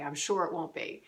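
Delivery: ordinary delivery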